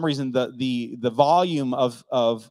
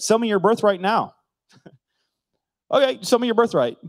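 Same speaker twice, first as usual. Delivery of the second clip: sarcastic demand; 8 semitones higher, 4 dB louder than usual. Acted. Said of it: "it's almost like a sarcastic reading".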